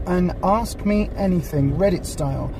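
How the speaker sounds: ordinary talk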